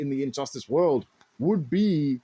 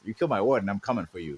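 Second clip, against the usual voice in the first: lower, quick mock Batman threat; low fast threat; 7 semitones lower, much faster than usual. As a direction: growl the threat out lower and faster. Batman voiced, threat repeated.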